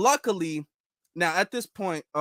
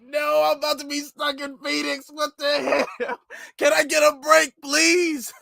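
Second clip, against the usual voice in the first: exasperated outburst; 6 semitones higher than usual. Retold or imitated. Stressed about missing flight.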